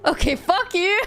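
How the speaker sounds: laughing. Real, not acted. Player insulted by narrator.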